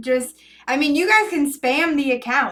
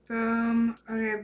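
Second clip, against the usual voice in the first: normal talking voice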